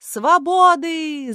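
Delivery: high, loud, long-drawn cry. A narrator's reading. Julie raising her hand to the crowd, crying out. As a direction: stretch it out as a ringing rallying cry. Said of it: "закричала Галя Жюли".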